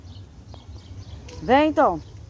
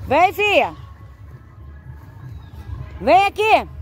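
high, loud call to come back; calling the dog; 9 semitones higher, 3 dB louder, slower than usual. Real, not acted. Owner calls her dog back across the field, loud and high. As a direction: summon her across the field, higher and louder.

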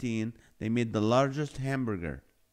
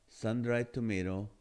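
ordinary talk

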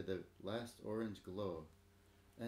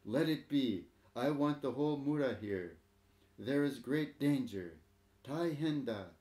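put-on loud, higher command to the young men; old headman calling out firmly; 4 semitones higher, 6 dB louder than usual. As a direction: order them to stand back and let it burn. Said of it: "he commanded".

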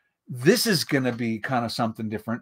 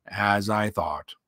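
low gloomy donkey; gloomy Eeyore; 5 semitones lower, 3 dB quieter, slower than usual. A narrator's reading. Gray old donkey judging his reflection, gloomy. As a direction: give it a resigned, low donkey voice. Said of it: "said Eeyore gloomily".

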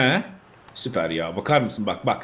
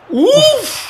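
excited groan; 4 dB louder than usual. Real, not acted. Groan at missed chance.

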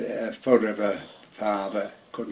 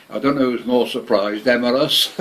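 normal talking voice

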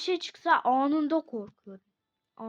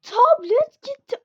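loud, high panicked cry over the lost tablet; shrieking that the tablet is gone; panicked yell; 11 semitones higher, 12 dB louder than usual. Real, not acted. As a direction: yell it like the tablet just vanished.

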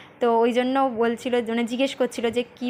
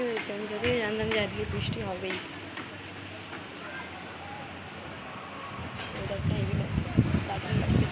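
speaking softly; 9 dB quieter than usual. Real, not acted. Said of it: "আমি আস্তে আস্তে কথা বলছি". (বাচ্চা ঘুমাচ্ছে বলে চাপা গলায় কথা)

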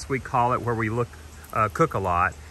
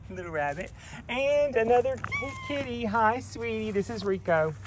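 high-pitched pet-talk; cooing at animals; 9 semitones higher, 3 dB quieter than usual. Real, not acted. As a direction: coo up high to a little kitty. Cooing at rabbit and kitty.

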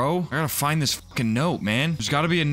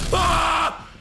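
high, loud shriek; real fright scream; startled scream; 3 dB louder than usual. Real, not acted. Startled by the beating heart, a high loud shriek.

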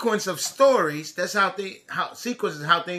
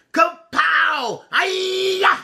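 ninja battle shout; 6 semitones higher, much slower than usual. Acted. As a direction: shout kung-fu cries and tease the chasers. Refrain of ninja taunt.

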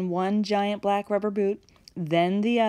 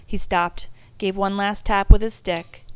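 ordinary delivery, nothing marked

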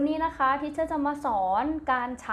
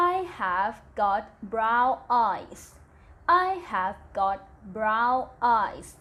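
slow dictation; much slower than usual. Shown as a demonstration. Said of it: "ตัวอย่างประโยคเช่นนะคะ".

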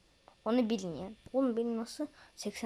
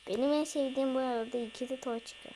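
ordinary delivery

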